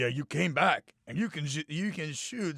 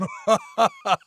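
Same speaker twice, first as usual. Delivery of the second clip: evil laugh; 7 semitones higher, 4 dB louder than usual. Acted. Villain warlock laughs.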